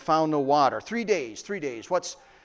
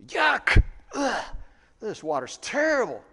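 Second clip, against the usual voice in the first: acted disgust; 6 semitones higher, 3 dB louder than usual. Thirsty people tasting bitter water, acted disgust. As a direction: recoil from a foul taste.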